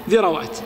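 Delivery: ذروات said dhirawāt, r carrying a short a; middle opened with a. Shown as a demonstration. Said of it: "إما التسكين أو الفتح". (ذروات براء مفتوحة)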